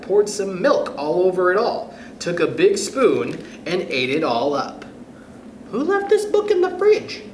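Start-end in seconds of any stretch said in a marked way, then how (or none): 5.7-7.2 high, startled question; a sudden startled cry; surprised high voice. Acted. Reader voicing a startled child finding the book in the fridge, high and surprised.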